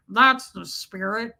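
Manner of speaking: slow, creepy imitation; Ted Levine. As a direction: stretch it out like a lightly voiced film creep. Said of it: "Ted Levine has the creepiest voice".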